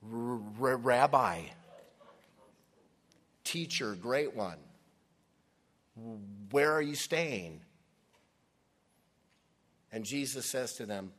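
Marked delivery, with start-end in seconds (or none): 0.0-7.6 nervous stutter, louder; tripping over the first word; stammering. Acted. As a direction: disciples caught off guard, stammering loudly. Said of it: "I see them sort of stammering".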